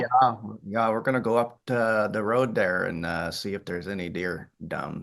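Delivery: put-on thick Upper Peninsula Yooper accent. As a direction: do the Fargo-style Yooper accent. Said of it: "the Minnesota accent in Fargo".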